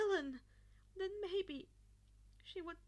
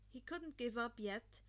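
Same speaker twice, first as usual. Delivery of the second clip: usual speaking voice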